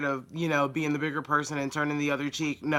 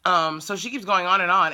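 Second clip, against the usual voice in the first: usual speaking voice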